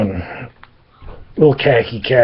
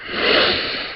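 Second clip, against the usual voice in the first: sigh; 5 dB quieter than usual. Real, not acted. Weary sigh mid-story.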